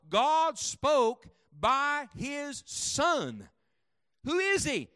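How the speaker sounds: loud, rising pulpit cadence, hammering each line; fiery revival preacher; preaching at full force. Acted. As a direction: preach it hard to the congregation.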